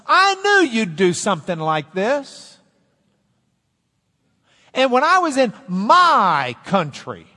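high, loud, indignant whine at God; Jonah's angry complaint. Retold or imitated. Jonah complaining to God after Nineveh is spared, high and indignant. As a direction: let the sulking prophet rage at the Lord. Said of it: "And that's Jonah's attitude".

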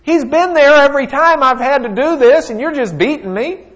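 high, loud aggrieved protest; donkey pleading its case, pitched high; complaining donkey. Acted. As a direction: whine it out like a wronged beast. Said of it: "And the donkey says".

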